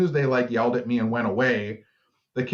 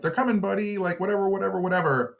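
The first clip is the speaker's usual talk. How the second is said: put-on kid soothing the bird; 9 semitones higher, faster than usual.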